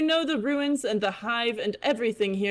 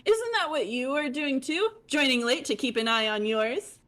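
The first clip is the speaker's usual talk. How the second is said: high bubbly chatty voice; high fish girl voice; 4 semitones higher than usual. Acted. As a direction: chirp it like a bubbly troll. Holds character voice.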